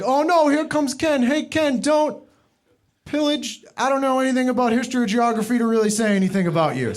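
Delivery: high mock alarm at Ken's arrival; mocking partygoer voice. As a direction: dread his arrival in a put-on high voice. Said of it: "they're like".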